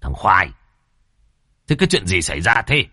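stern master calling a servant to account; brusque, scolding questioning; puzzled bark. A narrator's reading. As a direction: snap a quick, sharp demand. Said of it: "thì khó hiểu quát".

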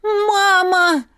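high loud cry; cried out. Acted. Mother cries out to her sick daughter. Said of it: "крикнула она".

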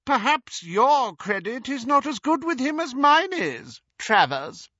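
high, loud, sneering threat; Bellatrix's contempt. Acted. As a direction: lord it over him coldly. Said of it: "imitation of Bellatrix's most contemptuous manner".

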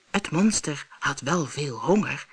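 usual speaking voice

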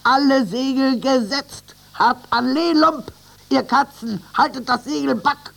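high, loud rapid commands; shouted orders. A narrator's reading. First mate commanding Lump and the animals, high loud shouts. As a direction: fire orders across the deck. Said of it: "rief der Maat".

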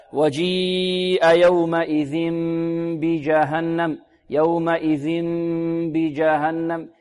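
"يومئذ بجهنم" chanted slowly, tanween turned to a long hummed m before the b; iqlab with ghunnah. Shown as a demonstration. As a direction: hold a nasal m into "بجهنم", lips lightly closed. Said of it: "هكذا". (إقلاب التنوين ميمًا مخفاة بغنة ممطوطة في "يومئذ بجهنم")

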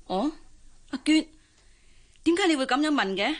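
startled, rushed question; surprised. Acted. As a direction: blurt it out in surprise. Old woman surprised by question.

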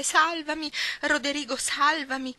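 high, loud desperate stage cry for help. Shown as a demonstration. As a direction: scream it like a melodrama heroine. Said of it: "e gridando disperatamente". (grido disperato e teatrale, acuto e forte)

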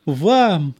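high, loud delighted exclamation; joyful cry. A narrator's reading. Former cardsharp greets the old police officer, joyful cry. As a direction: cry it out with glad surprise. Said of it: "радостно вскричал бывший шулер".

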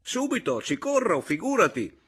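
loud, high, heated protest; agitated. A narrator's reading. Berto warns his brother about the wife, agitated. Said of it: "scattò Roberto quasi rabbiosamente".

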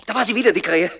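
spooked by the crow; startled fear. Acted. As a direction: jump at the crow's call. Hears the crow again, startled.

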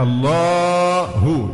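الله chanted with a very long held alif before the ha; excessive madd. Shown as a demonstration. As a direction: hold the second vowel of الله far too long, slowly. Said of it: "المد الشديد". (مد شديد في ألف «الله»)

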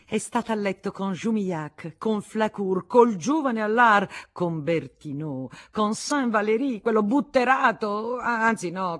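loud, high-pitched indignant tirade; tumultuous anger. A narrator's reading. Woman listing her rival's lovers to Federico, raging. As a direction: reel off each name as an accusation. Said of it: "la sua collera fece passare tumultuosamente".

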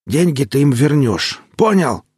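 threatening. Acted. Necromancer threatens the lawyer.

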